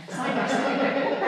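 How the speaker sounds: very slow, laughing emphasis; savoring. Real, not acted. Author setting her first books on the mantle, slow and proud. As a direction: draw it out proudly. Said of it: "proud display".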